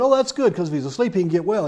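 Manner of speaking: high, eager "oh, that's good"; relieved disciples. Retold or imitated.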